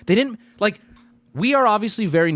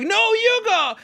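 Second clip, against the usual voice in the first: 1 mock polite insisting; slower than usual. Overly polite standoff with Japanese.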